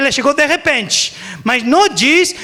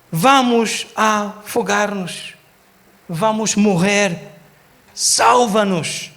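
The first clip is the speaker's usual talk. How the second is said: slow, measured delivery; calm, not panicked; 3 semitones lower, much slower than usual. Shown as a demonstration. Preacher shows how disciples did not plead, slow and calm. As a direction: spell out the plea slowly and evenly, without urgency. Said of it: "E eles não falavam assim".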